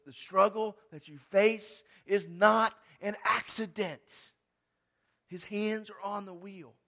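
loud, high, insistent pulpit voice; fiery sermon climax; preaching at full force. Acted. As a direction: hammer it home to the congregation.

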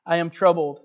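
preacher reading the testimony aloud, full voice; dramatic story reading. Acted. Preacher reading a Wigglesworth story to the congregation, dramatic. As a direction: tell the old healing story to the congregation.

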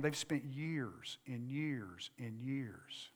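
low, soft preacher's voice with long pauses; hushed preaching. Real, not acted. Preacher urging the congregation toward daily scripture reading, hushed. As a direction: bring the sermon right down, quiet and low.

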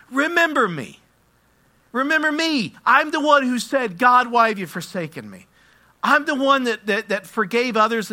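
loud, high declaration as Jesus; fervent shouted appeal; impassioned proclamation. Acted. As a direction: cry out each claim with full preaching force. Preacher voices Jesus speaking from the cup.

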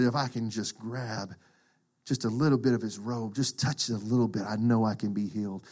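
soft, low, slowed hope; hushed desperate plea. Retold or imitated. The sick woman reaching for Jesus' robe, soft and hushed. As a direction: whisper your longing to just touch the robe.

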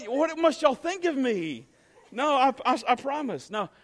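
usual speaking voice

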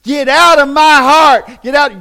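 loud commanding yell to cast it out; yelling at a demon. Shown as a demonstration. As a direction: scream it out at the demon. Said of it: "probably start yelling".

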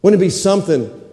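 ordinary talk

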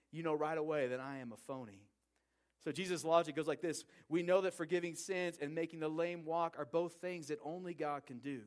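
ordinary talk

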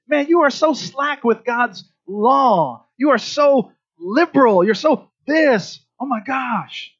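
high, slow disgusted scolding; mock the lax rabbi with disdain; scornful critics. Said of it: "these guys were like".

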